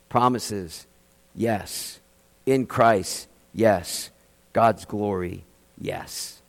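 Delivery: a quiet, fading call and answer; hushed litany. Acted. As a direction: let each yes sink to almost nothing. Pastor's soft litany of promises.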